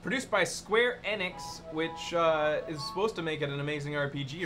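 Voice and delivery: ordinary delivery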